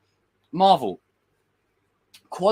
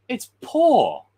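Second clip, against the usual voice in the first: blunt verdict; 7 semitones higher, 6 dB louder than usual. Retold or imitated. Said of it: "I would say".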